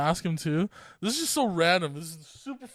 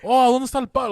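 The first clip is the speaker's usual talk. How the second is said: Cuban Spanish; 6 semitones higher, 8 dB louder, faster than usual. Retold or imitated. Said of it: "So then I was just like".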